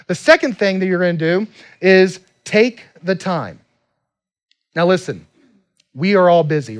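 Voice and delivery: fired-up sermon voice pushing people to join groups; preaching at full force. Acted. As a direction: drive it home to the congregation like a pastor. Preaching to the congregation.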